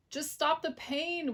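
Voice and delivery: high, slow whimper. A narrator's reading. Fox whimpering to the dentist while wiping tears. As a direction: plead tearfully. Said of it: "whimpered the fox".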